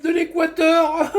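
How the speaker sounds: very high put-on caricature voice; hammed-up high-pitched impression with laughter; mocking imitation. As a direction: mimic him in a silly high squeal, laughing. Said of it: "Et il parle comme ça".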